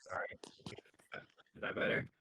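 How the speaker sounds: muffled, like through water; bad mic, voice garbled underwater. Real, not acted. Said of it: "it sounds like you're underwater".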